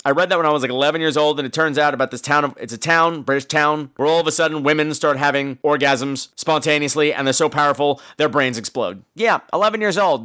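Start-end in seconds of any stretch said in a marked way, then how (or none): none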